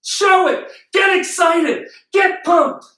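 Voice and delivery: high, punchy commands; fired-up urging. Real, not acted. Motivator urging the audience to show enthusiasm, fired up. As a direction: rouse the crowd in short bursts.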